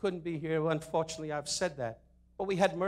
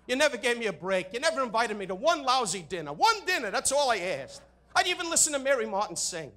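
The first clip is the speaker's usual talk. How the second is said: higher, mock aggrieved whine; whiny complaint; 6 semitones higher than usual. Comic whining at the president over no dinner invite. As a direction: whine like a snubbed friend. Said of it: "complaining to the president".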